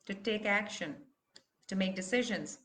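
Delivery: normal talking voice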